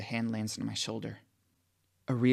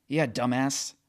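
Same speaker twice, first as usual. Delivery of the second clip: flat; 3 semitones higher, 7 dB louder, slower than usual. A narrator's reading. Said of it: "Fitch says flatly".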